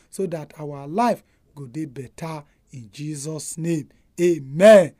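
softly spoken prayer. Real, not acted. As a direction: pray it earnestly and gently. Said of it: "May we pray".

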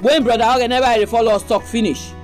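ordinary talk

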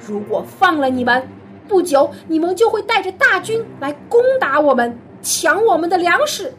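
the vizier condemning the brothers as spies; stern accusation. Acted. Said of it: "约瑟严厉地说". (严厉地指责)

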